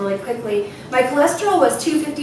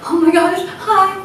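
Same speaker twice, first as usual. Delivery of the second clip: high, loud gushing greeting; starstruck; 8 semitones higher, 8 dB louder, much slower than usual. Retold or imitated. Patient meets her hero doctor, starstruck. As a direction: squeal hello like a fan.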